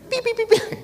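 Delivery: very high squeaky beeps; cartoon beeping. Child dazed after the blow, high squeaky beeping. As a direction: squeak like a cartoon dizzy sound.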